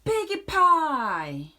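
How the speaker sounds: high, slow witchy drawl of piggy pie; gleeful witch. Acted. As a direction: savour it like a hungry witch. Witch gloating over piggy pie.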